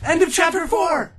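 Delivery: triumphant call. Real, not acted. Triumphant announcement.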